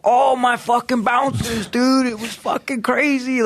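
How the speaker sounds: high excited shouting voice; excited retelling. Retold or imitated. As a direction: shout it like a club owner who can't believe it. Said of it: "He's like".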